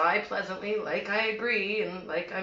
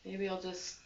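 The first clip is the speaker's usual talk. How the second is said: much softer, with long pauses; hushed, halting menace; quiet veiled threat; 10 dB quieter than usual. Acted. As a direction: let it trail out softly, ominously. Veiled threat closing the monologue.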